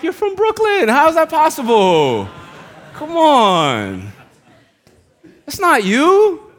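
high, loud mock disbelief; incredulous protest. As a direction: imitate exasperated relatives, voice shooting way up. Family protests uncle's new British identity.

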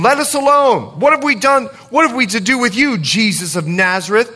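demon's high-pitched protest, acted by a preacher; dramatised outcry from a possessed man; crying out. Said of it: "he cried out, saying".

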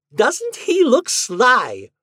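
high, loud, drawn-out teasing; arch teasing. Acted. Miss Hinkle remarks on the squirming husband, archly teasing. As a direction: crow it slowly, high and loud.

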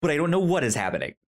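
fast, tumbling confusion; rapid bewildered babble. Retold or imitated. Retelling the confusing film to cohost, fast and bewildered. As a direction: spill the words out fast, puzzled.

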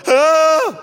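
loud, high mock wail; imitated wailing cry. Seatmate crying so the whole plane hears, mock wail. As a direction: burst into a hammed-up bawl. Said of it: "like wailing, crying".

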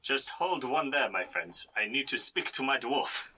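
put-on French accent for a character. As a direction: speak like a hammy Frenchman. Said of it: "say that in a French accent".

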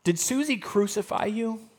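ordinary talk

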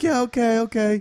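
high, dismissive okay; drunk girls giving up. Retold or imitated. The girls give up on him, in a high, bored voice. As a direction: brush it off like a bored drunk girl. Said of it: "they're like".

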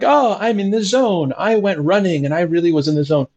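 a hint of a breezy runner bragging; casual jogger. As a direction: toss it off like a pleased jogger. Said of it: "listening to your favorite tune, like".

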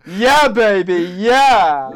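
slow, loud groovy Austin Powers drawl. Pretend. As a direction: purr it like a groovy swinger. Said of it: "Gotta do my Austin Powers".

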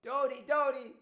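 very loud, high-pitched calling shout; screaming out. Acted. Woman searching for Dodi in the fields, screaming out. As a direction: cry the name out to someone far away. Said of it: "someone was screaming out".

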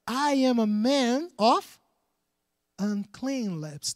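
slow, heavy, drawn-out confession. Acted. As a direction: let each word sink, very slowly. Preacher voicing Isaiah's confession again.

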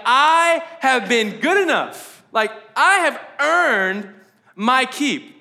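high, slow, emphatic self-justification; every word stretched and pushed; entitled protest. Acted. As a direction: act out a son insisting on his due. Acting the son's entitled mindset.

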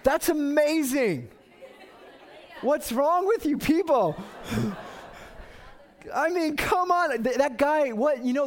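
high, loud, slow exclaiming; excited exclaiming. Real, not acted. Preacher to congregation, loud amazed exclaiming. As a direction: shout the wonder out to the room.

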